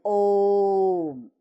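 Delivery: ओम held long and stretched out slowly; teacher stretching ओम to show a prolonged vowel; drawn-out Om. Shown as a demonstration. Teacher demonstrates stretching ओम for children. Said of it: "इसका उच्चारण में खिंचाव होता है".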